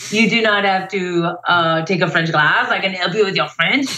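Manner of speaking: imitated Frenchman speaking English; French-accented offer of help, playfully imitated. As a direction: put on his French accent for the reply. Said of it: "French accent".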